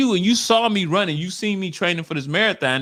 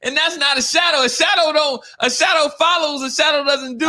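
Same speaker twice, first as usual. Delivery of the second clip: frustrated retort; 8 semitones higher than usual. Retold or imitated. Scolding the clingy gym client, high and exasperated. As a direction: raise the pitch and let the exasperation pour out.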